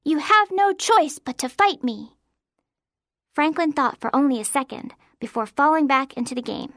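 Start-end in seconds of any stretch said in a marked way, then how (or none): 0.0-2.2 high, imperious challenge; challenging ghost knight. A narrator's reading. Girl challenges her friend to a sword fight, high grand voice. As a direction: throw down a play-acting dare.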